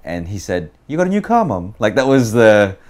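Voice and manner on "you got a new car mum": imitated British lilt leaping high on CAR; brother's British inflection, pitched up and louder; British question inflection. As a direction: ask it with a swooping British lilt. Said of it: "slight British inflection when he asks a question".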